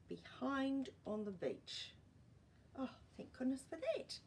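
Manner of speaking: plain reading voice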